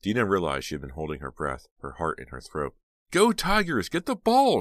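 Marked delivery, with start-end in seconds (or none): 3.1-4.6 piercing high scream. Acted. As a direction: scream at full voice. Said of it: "she screamed".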